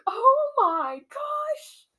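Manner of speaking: very high, loud, drawn-out wail; mock overwhelmed wail. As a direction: re-enact crying over a book. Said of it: "I cried so hard during that".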